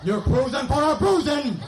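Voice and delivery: 천천히 끌면서 웃음 섞인 아빠의 경고; slow, deliberate warning with a laugh in it; dad losing patience. Retold or imitated. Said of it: "watch your dad do that slow burn".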